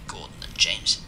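plain reading voice

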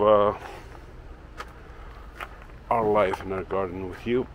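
normal talking voice

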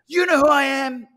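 loud, high-pitched mock bellow; Steve Hardison shouting. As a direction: throw out the challenge at full voice. Steve's retold boast, shouted.

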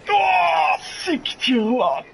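high, loud, slow angry cry; angry shout. Real, not acted. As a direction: yell it out in frustration. Killed in game, cursing.

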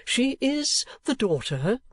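name her slowly and carefully; kindly old gentleman, deliberate. Acted. Old gentleman telling Nicholas who she is, deliberate.

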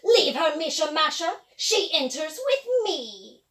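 loud commanding witch. Acted. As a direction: order the crow away.

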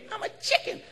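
high breathy timid bird; squeaky whispered bird. Retold or imitated. The bird whispering back its refusal. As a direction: shrink away, whispering.

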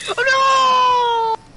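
sharp high cry of dismay; dismayed shout. Real, not acted. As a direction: yell it as your teammate falls. Teammate goes down in fight.

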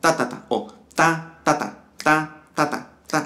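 first "ta" held long, next two quick; uneven triplet count. Shown as a demonstration. Teacher showing students the mistake, lopsided triplet count. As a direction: chant "ta, ta, ta" with one note stretched. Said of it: "Hay una nota más larga".

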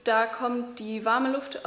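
ordinary talk

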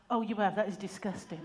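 ordinary talk

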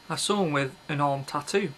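ordinary talk, nothing marked

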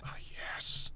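hushed and satisfied under his breath; pleased whisper. Real, not acted. To viewers, as the axis lines up, in a pleased whisper. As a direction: whisper it like a small private win.

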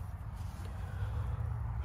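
long, heavy exhale; heavy sigh. Real, not acted. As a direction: sigh out the pain of parting. This hurts me.

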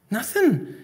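high-pitched innocent answer; over-bright denial. Acted. Son hiding trouble from ma, over-bright. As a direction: pipe up as if nothing is wrong. Boy evades ma's question.

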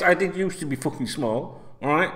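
usual speaking voice

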